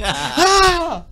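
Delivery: ordinary talk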